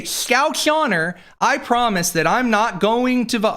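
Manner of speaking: high, slow mock pleading voice; sing-song fake reassurance; mock promise.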